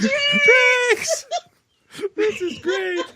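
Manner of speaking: high shout through laughter; laughing shout. Real, not acted. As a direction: shout it while cracking up.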